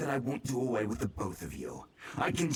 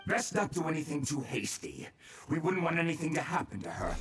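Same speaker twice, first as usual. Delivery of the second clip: ordinary talk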